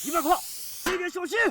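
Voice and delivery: high, loud urgent yell; shouted warning. Acted. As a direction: bellow a warning across the battlefield. Firefight, warning comrades under fire. (高声急喊提醒战友)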